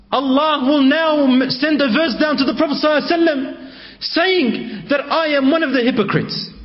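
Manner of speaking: high, frantic cry of dread; retold cry of horror, pitched high; high panicked cry. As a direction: cry it out high as if caught in sin. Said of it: "he screamed so high and so, he yelled so loud".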